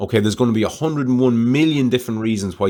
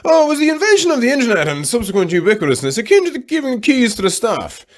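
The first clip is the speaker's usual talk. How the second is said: much higher, theatrical mock-profound voice; hammed-up pompous musing; 4 dB louder than usual. Man imagining his wife overhearing him, mock-grand philosopher voice. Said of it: "hear me going something along the lines of".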